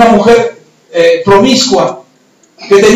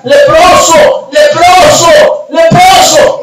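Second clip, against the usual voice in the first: high, slow cry of warning; drawn-out, high-pitched public warning call; warning shout; 7 semitones higher, 3 dB louder, much slower than usual. Acted. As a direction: shout it to clear the road. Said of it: "tenían que empezar a gritar".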